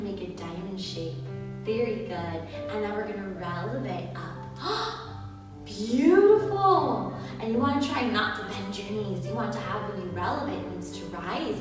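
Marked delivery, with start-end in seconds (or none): 4.5-5.6 high breathy gasp of delight. Acted. Teacher gasps in delight. 5.6-7.1 slow, loud, high "Beautiful"; drawn-out praise. Acted. Praising child dancer.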